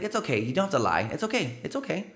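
high, sing-song fake it's okay; sarcastic reassurance. Telling the relative not to lie, sarcastically high. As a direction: pat them on the head, mocking. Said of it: "I was like".

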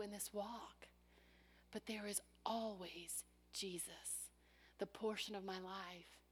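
soft unvoiced hushed words; reverent whisper. Real, not acted. Preacher confiding to congregation in a reverent whisper. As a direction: share it in a hush.

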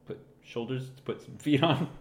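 ordinary talk, nothing marked

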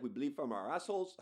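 put-on not-quite South African accent; put-on foreign accent. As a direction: mimic a man in a badly faked South African accent. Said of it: "I don't know why I'm doing that accent".

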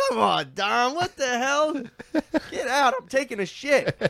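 high mock-irritated yelling; annoyed dad. Dad on the toilet shooing son, high mock annoyance. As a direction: shoo the kid out, exaggerated. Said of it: "He's like".

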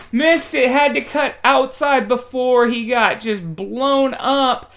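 high, loud burst of astonished play-by-play; excited play call. Real, not acted. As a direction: call the big play at a high pitch, peaking in a shout. Live call of a big play.

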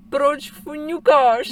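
high, very slow drawn-out Proč fňukáš; exasperated parent asking slowly; imitating herself scolding a whiny kid; mock annoyed parent. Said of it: "já vždycky říkám".

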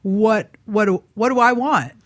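usual speaking voice

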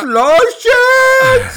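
Slow shit! yelled high and loud; loud mock Russian. Mocking the Russian word for listen, loud high yell. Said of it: "sounds like he's saying bull something".

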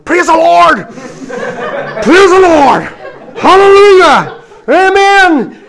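very high, very loud, drawn-out shouted praise; shouted praise through pain. Retold or imitated. Plumber with a hammered thumb, shouting praise loudly. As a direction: yell hallelujah while clutching a hurt thumb. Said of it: "holding my thumb going".

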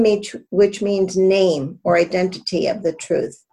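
normal talking voice

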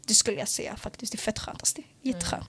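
breathy whisper, voice almost gone. Real, not acted. Host confiding to co-hosts, whispered. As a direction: let it drop to a confiding whisper.

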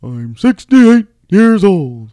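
drawn-out, loud ring-announcer impression; Howard Finkel. Host imitating a birthday ring announcer, booming announcer voice. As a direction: announce it high and slow to the whole arena. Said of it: "You kinda sound like a mixture of him".

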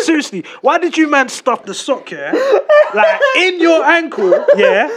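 high, loud amused disbelief; incredulous. Real, not acted. Incredulous laughing rant.

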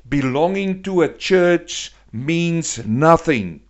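high, loud pulpit drive; full-force preaching. Acted. Preacher warns the congregation, loud and driving. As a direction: hammer each line home to the congregation.

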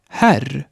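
Herr as Swedish här, wide open vowel; very open E before R. Shown as a demonstration. Teacher models Herr for learners, very open E. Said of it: "Noch offener wird das E".

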